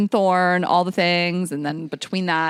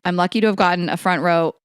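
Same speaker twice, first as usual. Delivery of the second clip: usual speaking voice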